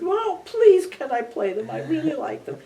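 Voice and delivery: put-on high, pleading young girl's voice; eager young girl. Composer voicing the young flutist pleading to play. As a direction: beg sweetly like a girl asking a favor. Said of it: "She said".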